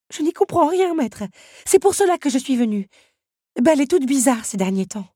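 high, loud, fast fretting; worried teapot. A narrator's reading. Madame Samovar telling the master Belle is missing, high and fast. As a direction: rush the worry out.

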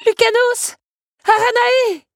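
high, loud strained cry; choked-up calling. Acted. Belle calls her two helpers, voice choked. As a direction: call out urgently with a knot in your throat. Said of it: "la voix nouée".

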